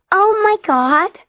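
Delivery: very high, loud, drawn-out squeal; excited squeal. A narrator's reading. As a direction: squeal it like an astonished girl. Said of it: "Nudge squealed".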